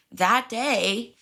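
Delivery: ordinary talk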